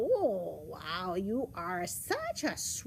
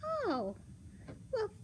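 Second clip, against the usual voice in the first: put-on high little-girl voice; sweet high storybook child; 9 semitones higher than usual. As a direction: play a naive, pleased girl, pitched high.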